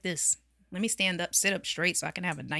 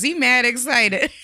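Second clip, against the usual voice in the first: laughing; 4 semitones higher, 7 dB louder than usual. Real, not acted. Teasing friend over his excitement.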